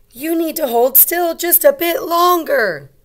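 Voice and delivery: bright call to the puppy; little girl voice. Acted. Teacher voicing the little girl calling Biscuit, high and cheerful.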